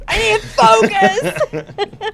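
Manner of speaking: high, loud, drawn-out through laughter; laughing insistence. Real, not acted. Host trying to regain focus after joking, laughing. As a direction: crack up while stretching out the words.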